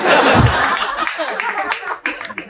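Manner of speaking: giggling high and breathy; high laugh. Real, not acted. Laughs at own joke.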